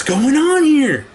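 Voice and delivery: loud, slow disbelieving outburst; exasperated outburst. Real, not acted. Customer venting at Walmart, loud exasperated demand. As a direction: throw up your hands and demand an answer.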